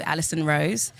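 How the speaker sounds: usual speaking voice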